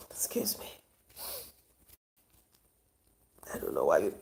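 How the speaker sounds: breaking down mid-story, asking for a moment; choked up. Real, not acted. Vlogger pausing the story to viewers, crying in a whisper. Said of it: "this is becoming too sad for me".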